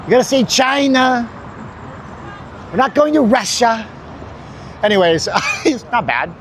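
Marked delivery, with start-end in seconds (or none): 0.0-3.9 hammed-up Trump impression. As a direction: imitate the president's cadence. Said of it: "you gotta talk like this".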